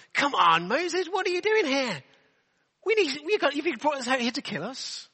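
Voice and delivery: high, whiny complaint at Moses, slowing down; grumbling Israelites. Retold or imitated. Israelites grumbling at Moses in the desert, high whiny complaint. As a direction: moan like a fed-up crowd in the desert. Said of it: "they're grumbling at Moses".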